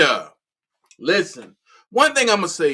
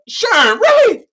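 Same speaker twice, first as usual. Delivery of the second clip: much higher, loud cry of disbelief; 14 semitones higher, 10 dB louder, slower than usual. Retold or imitated. Watching a live argument, shrieking in disbelief. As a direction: squeal it in shock. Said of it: "I'm saying to myself".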